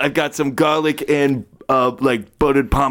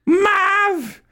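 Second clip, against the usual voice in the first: put-on shrill, slow scolding old woman; high old lady voice; slower than usual. NPC Mrs Ferguson scolds.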